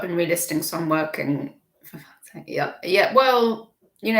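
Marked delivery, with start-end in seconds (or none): 1.8-2.4 muttered curse. Real, not acted. Muttered curse under breath.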